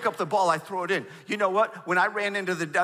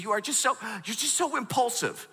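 high, exasperated mock complaint; retold marital spat; 7 semitones higher than usual. Wife scolding impulsive husband on the drive home, mock exasperated. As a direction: imitate a fed-up wife mid-argument. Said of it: "in the car having a fight".

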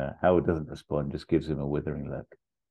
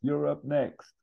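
high-pitched call to the stage; heckling barman; 10 semitones higher, slower than usual. Acted. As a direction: call out the next act. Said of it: "the barman just goes".

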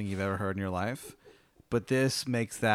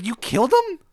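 high, loud outraged question; shrieked disbelief; 11 dB louder than usual. Acted. Linda outraged that you killed him, shrieked disbelief. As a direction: screech it in horrified disbelief.

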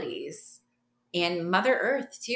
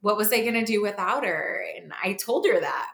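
usual speaking voice

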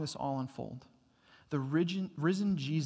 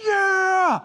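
triumphant yell; 13 dB louder than usual. Retold or imitated. Kid pops up cheering.

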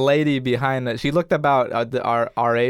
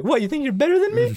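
put-on squeaky Mickey Mouse-like kid; mock challenge in a high cartoon-child voice; little Mexican boy. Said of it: "this little Mexican boy sounds".

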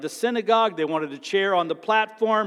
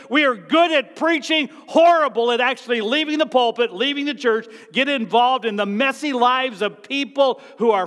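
higher, louder pulpit fire; full-force preaching; 7 semitones higher, 6 dB louder than usual. Real, not acted. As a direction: preach it with passion and rising heat.

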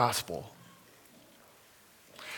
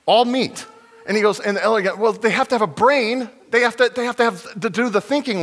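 excited alien; 9 semitones higher, 5 dB louder, faster than usual. Retold or imitated. Aliens arguing in retold story.

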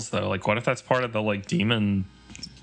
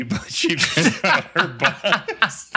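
laughing; 12 semitones higher, 3 dB louder, much slower than usual. Real, not acted. Laughing through a joke.

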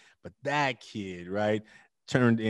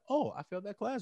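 mock casual student; 10 semitones higher, 7 dB quieter than usual. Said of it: "it ain't gonna be like".

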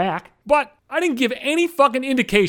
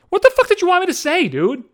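high-pitched exasperated protest; indignant; 10 semitones higher than usual. Real, not acted. Podcaster answering people who called him a dick, indignant. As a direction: push back at the critics, voice jumping up. Rejects criticism of his blunt refusal.

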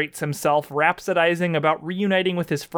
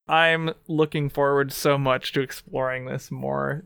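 ordinary talk, nothing marked

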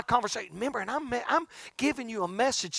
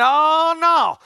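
1 friend's loud objection; 8 semitones higher, 9 dB louder than usual. Retold or imitated. Grady insists.

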